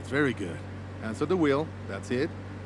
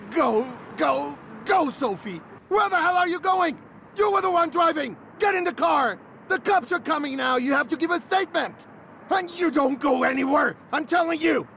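angry shouting; 5 semitones higher than usual. Acted. Instructor to fleeing student after the crash: angry shouting. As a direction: order her back furiously.

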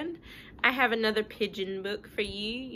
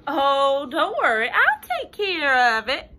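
eager pigeon promise; 4 semitones higher, 9 dB louder than usual. Acted.

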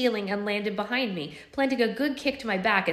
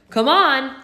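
loud challenging shout; 7 semitones higher, 11 dB louder than usual. Acted. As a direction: yell it at the troops. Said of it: "I shouted at them".

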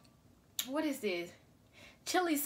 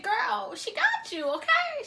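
much higher playful squeal; squeaky high voice; 10 semitones higher, 3 dB louder, slower than usual. Real, not acted. Hyped self-talk.

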